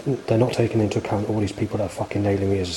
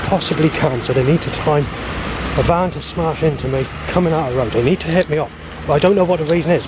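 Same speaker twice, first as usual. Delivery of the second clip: loud, higher-pitched agitated complaint; agitated rant; 7 semitones higher, 5 dB louder than usual. Real, not acted. Speaker protesting threats from a van, agitated rant. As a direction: rant it out, raised and insistent.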